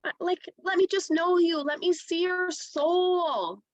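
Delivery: a hint of a pushy spiritual marketer; mock guru marketer. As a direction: imitate a coach claiming to see your soul. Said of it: "people that were in my inbox going".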